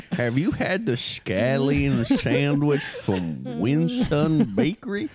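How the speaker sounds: put-on very slow, drawn-out old-timer; hammed-up old man drawling a question; folksy old man. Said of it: "he's kind of folksy".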